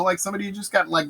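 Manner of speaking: usual speaking voice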